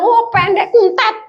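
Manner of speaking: high-pitched, mock-exasperated jeer; exasperated taunt. Bullied person snapping back at the short child, exasperated. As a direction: tease back as if fed up. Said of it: "Gemes saya bilang".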